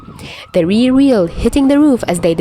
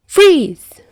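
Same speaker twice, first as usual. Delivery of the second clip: sharp high shout, much louder; yelled freeze; 10 semitones higher, 5 dB louder than usual. Acted. Police officer shouting at the runaways, loud high yell. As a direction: bark it like a cop stopping a suspect.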